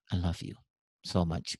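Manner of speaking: low, soft acted declaration of love; tender father. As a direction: say it gently and low, as to your child. Father tells child love by phone. (ayah lembut, suara rendah)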